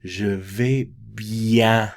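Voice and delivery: teacher showing the phonetics, slow and careful; slow pronunciation demo. Shown as a demonstration. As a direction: say "Je vais bien" at half speed, every sound clear. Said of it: "the J is a Y".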